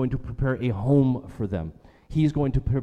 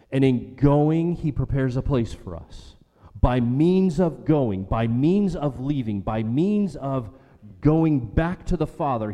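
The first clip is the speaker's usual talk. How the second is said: preaching at full force; 3 semitones higher than usual. Acted. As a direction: drive it home to the congregation. Preaching climax.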